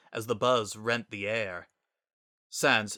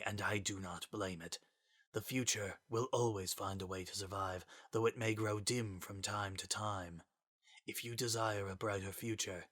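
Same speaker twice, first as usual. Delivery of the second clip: soft, grave and formal; solemn Gaster voice; 9 dB quieter than usual. Acted. Sans voicing Gaster's words.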